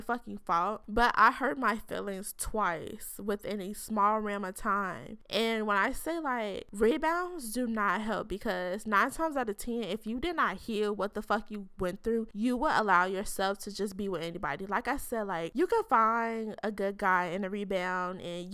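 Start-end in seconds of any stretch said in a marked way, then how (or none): none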